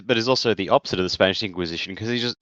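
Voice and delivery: ordinary delivery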